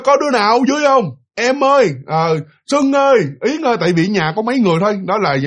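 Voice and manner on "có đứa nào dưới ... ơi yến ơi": calling out. Retold or imitated. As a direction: call the household from upstairs. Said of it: "Ổng la nó kêu".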